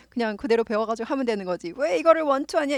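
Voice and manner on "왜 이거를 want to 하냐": higher, louder mock grumble; mock complaint. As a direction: play the irritated learner. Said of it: "이러지 마세요".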